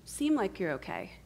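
soft, caring friend; gentle concern. Acted. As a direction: offer comfort quietly, without pushing. Acts a caring friend's gentle response.

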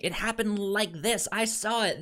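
high, insistent eyewitness voice; a lightly voiced witness swearing it happened this way; high mock witness. Podcaster mimicking a witness, high and insistent. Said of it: "person saying".

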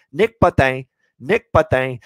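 loud, high-pitched French-accented name. Shown as a demonstration. Host relishing saying a player's name, in a French accent. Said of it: "saying his name in the French accent".

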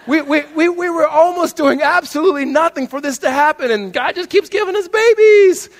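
high, loud, excited squeal; gushing. Acted. Acting out an excited, boasting parent.

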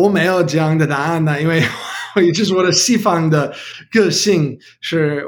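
American-accented learner Mandarin; careful second-language Mandarin, English accent; foreigner speaking mandarin. Real, not acted. Non-native learner speaking Mandarin throughout.

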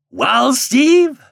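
put-on high, loud folksy old man; old Ben. A narrator's reading. Old Ben greeting Steve on the wharf, high folksy old man. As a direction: drawl it like an old wharf hand. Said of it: "shifting his quid of tobacco in a leisurely manner".

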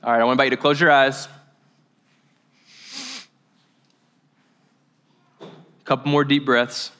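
calm, subdued meditation guide; guided meditation. Real, not acted. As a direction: settle a group into stillness, pausing between phrases. Leads breathing and meditation.

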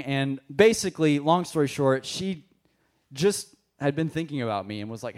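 usual speaking voice

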